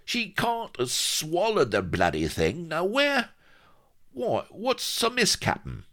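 very high-pitched sidekick voice, swearing in exasperation; high, excitable crewman; agitated pirate sidekick. A narrator's reading. Voices Vladimir in story.

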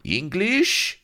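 loud, high, rising Spanish-soldier challenge; truculent demand. A narrator's reading. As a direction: challenge them as a hostile foreign soldier. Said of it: "he demanded truculently".

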